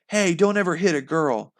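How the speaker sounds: yell. Acted. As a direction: yell to stop him. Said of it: "I yelled".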